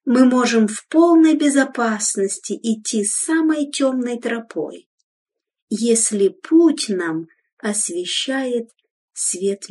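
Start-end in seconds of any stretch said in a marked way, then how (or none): none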